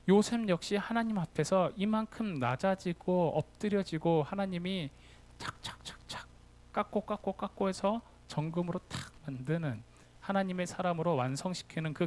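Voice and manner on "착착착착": chiselling noise. Retold or imitated. Imitating chiselling sound.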